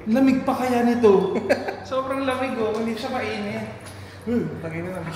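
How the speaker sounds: usual speaking voice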